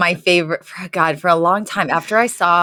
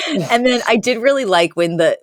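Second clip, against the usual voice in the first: normal talking voice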